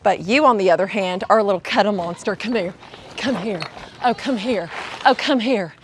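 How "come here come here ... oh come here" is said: cooing to pet. Real, not acted. Cooing to cuddly goat.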